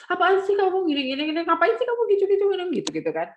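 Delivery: acted angry girl nagging; angry snapping. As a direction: snap at a friend in annoyance. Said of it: "marah-marah gitu".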